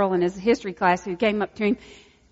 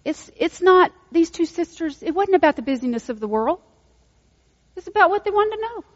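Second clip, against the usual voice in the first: usual speaking voice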